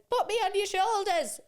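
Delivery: put-on high, loud little-girl demand; a small girl insisting on a ride; imitating demanding toddler. Daughter demanding to be carried, loud high toddler voice.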